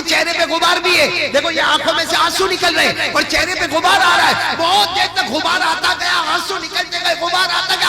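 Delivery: high, loud impassioned sermon; loud fervent preaching climax. Acted. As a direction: preach it at full force to the crowd. Preacher at full force.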